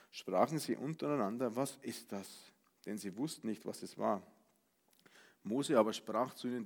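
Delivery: steady Bible reading voice; solemn scripture recital. Acted. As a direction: read the passage plainly and gravely. Reads Exodus passage aloud.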